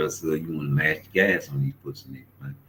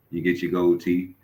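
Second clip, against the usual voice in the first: ordinary talk, nothing marked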